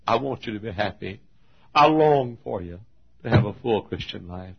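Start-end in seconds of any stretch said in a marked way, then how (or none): 1.7-4.5 earnest plea. Real, not acted. First, measured take of the plea.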